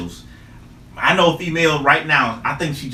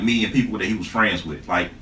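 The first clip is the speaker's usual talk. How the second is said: ordinary talk, nothing marked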